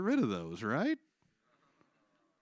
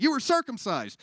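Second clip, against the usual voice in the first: high accusing cry; 10 semitones higher, 7 dB louder than usual. Acted.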